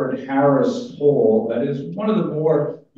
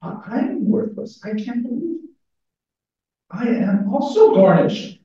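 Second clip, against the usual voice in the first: high, hammed-up lament; higher mock-tragic moaning; mock wail; 9 semitones higher than usual. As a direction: wail it like a theatrical penitent. Character laments his worthlessness.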